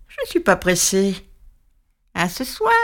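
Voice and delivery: lightly voiced witch, sneering; the witch gloating over her prey; mocking witch. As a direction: gloat like a patient old witch.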